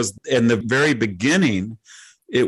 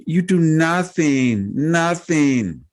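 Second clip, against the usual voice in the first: high, drawn-out mock scolding; insulting; 6 semitones higher, much slower than usual. Visitor berating him about airspace, mock insulting. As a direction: imitate a scornful visitor, stretching the words slowly. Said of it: "started kind of being insulting".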